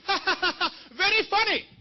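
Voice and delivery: high, loud forced mock laugh; hammed-up accented sarcastic heckler. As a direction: fake a staccato laugh, then pointed sarcasm.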